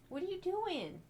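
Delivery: high, soft coo to her cat; high soft pet voice. Real, not acted. Cooing at the cat.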